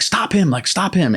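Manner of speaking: high urgent cry, not shouted; retold panicked plea. Veteran re-enacting plea to crewmate as leg is caught, high panic.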